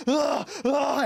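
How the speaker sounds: high, strained scream of a victim; acted scream. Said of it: "he screams out".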